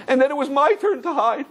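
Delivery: high-pitched imitated child's crying; acted tearful child; weeping boy. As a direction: wail it like a little boy, breaking into mock sobs. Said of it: "the boy started weeping heavy, heavy".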